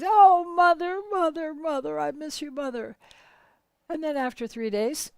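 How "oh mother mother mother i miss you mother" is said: a burst of loud, high crying that fades out; wailing for mother. Acted. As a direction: sob the first oh, then let it die away. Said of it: "allowing himself to weep for mother".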